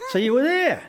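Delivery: high, loud mock questioning; oblivious older passenger. Older passenger questioning the comedian, high loud oblivious voice. Clueless passenger replies, retold.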